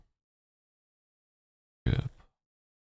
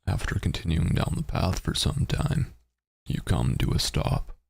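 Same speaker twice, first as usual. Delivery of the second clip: narrating a text adventure; 2 semitones higher than usual. Acted. Reads text adventure aloud.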